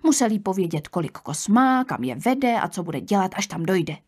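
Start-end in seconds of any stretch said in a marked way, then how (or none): none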